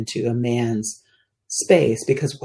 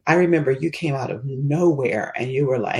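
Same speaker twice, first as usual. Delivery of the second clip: ordinary talk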